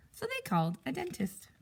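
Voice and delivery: ordinary delivery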